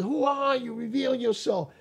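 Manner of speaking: yelling up at a voice he can't see; retold yell. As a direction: cry out at the unseen voice. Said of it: "I started yelling".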